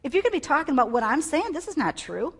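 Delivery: fast, high, loud angry tirade; Paul. Acted. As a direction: fire it off like a fed-up rebuke. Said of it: "so angry that he was like".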